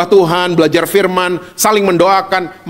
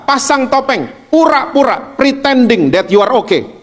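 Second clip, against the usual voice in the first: lightly voiced tempter, pitched up; bujukan iblis; 6 semitones higher than usual. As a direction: coax them to hide, raised pitch. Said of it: "iblis ngomong gini". (suara iblis membujuk, nada agak tinggi)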